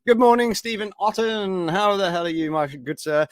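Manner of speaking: high, bright hello to a regular; cheery greeting. Real, not acted. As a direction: lift the voice high to welcome him. Greeting a viewer in chat.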